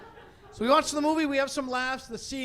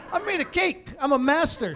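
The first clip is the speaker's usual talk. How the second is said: put-on high, smug kid voice; mock a bragging seven-year-old; higher, puffed-up child showing off; 6 semitones higher, 3 dB louder than usual. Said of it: "parades along like".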